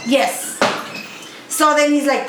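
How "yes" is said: normal talking voice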